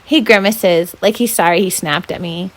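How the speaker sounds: ordinary delivery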